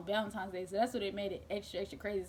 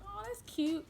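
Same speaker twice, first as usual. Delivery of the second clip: acted cooing; 11 semitones higher than usual. Acting her own reaction to news.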